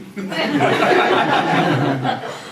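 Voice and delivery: long falling laugh. Real, not acted. Laughs after accent story.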